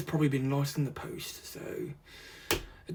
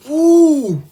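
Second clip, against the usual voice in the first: delighted ooh; 12 semitones higher, 14 dB louder than usual. Real, not acted. Opening the lovely box.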